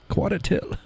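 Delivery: high-pitched, soft New Hampshire accent. Shown as a demonstration.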